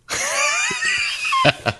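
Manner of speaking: high squealing laugh; shrieking laugh. Real, not acted. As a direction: let out a wild shriek of laughter. Wild laugh.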